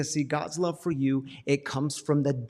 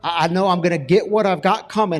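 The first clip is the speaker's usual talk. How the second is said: louder, quicker certainty of getting what he deserves; a man dreading making amends; bracing for punishment; 3 semitones higher, 7 dB louder, faster than usual. Retold or imitated. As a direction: rattle off the doom he expects. Retold dread before amends.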